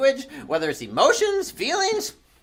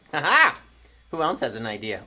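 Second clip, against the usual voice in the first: usual speaking voice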